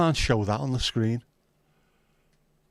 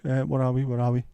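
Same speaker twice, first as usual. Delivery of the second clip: ordinary talk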